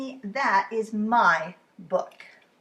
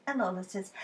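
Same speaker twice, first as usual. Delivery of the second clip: ordinary delivery, nothing marked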